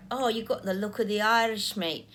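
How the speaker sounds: cheerful put-on Irish accent, hammed up. Psychic to viewers, playing a lucky Irishman, in a mock Irish accent. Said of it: "Excuse my Irish accent".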